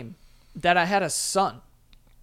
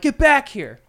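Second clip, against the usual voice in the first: loud, higher shouted order; retold parent yelling down the street; calling them back; 8 semitones higher, 7 dB louder than usual. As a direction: holler it as a command. Ordering runaways back up the block.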